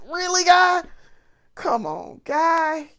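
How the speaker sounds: high incredulous plea, jumping up on "guy"; exasperated disbelief. Real, not acted. Woman to coworker who should know better, exasperated.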